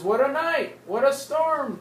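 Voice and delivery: loud, high excited cry; lively storm exclaim. Acted. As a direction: call it out like a startled mole. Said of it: "Mole mutters".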